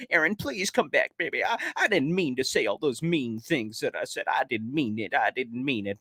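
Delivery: lightly voiced begging apology; mock pleading. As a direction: fan begging Aaron Rodgers to come back, mock heartfelt. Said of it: "I was on the intercom this morning".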